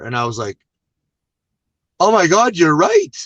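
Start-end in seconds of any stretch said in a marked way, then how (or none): 2.0-3.1 high, loud burst of agreement; excited realisation. Retold or imitated. Athlete won over by his friend, excited outburst. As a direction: re-enact a sudden revelation, pitch leaping up.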